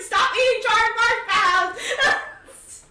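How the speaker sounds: loud, high, slowed-down yell broken by laughter; laughing yell. Acted. Mikhail to Damaris at the campfire, laughing yell. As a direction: bellow it while cracking up.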